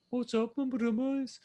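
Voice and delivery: high, slow Brummie sing-song; Brummie accent. Acted. Showing the co-host how Brummies talk, high Brummie accent. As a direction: put on a high-pitched Birmingham accent. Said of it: "people from Birmingham a Brummie".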